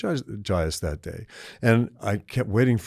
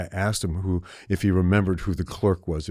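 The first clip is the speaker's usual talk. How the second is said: normal talking voice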